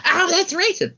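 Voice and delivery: high, hammed-up offended YouTuber; mock offended. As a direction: parody someone crying racism. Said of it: "they would have gone".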